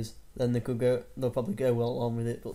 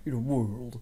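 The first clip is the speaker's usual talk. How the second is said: mock movie-trailer narrator, drawn-out; hint of a booming trailer voice-over; deep trailer voice. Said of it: "that guy with the proper deep voice".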